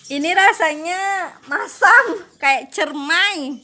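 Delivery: puckered, slow, tasting something sour; eating sour fruit. Real, not acted. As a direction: talk through a sour mouthful.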